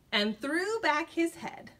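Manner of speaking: ordinary delivery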